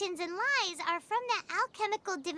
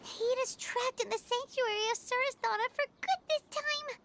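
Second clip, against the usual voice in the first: usual speaking voice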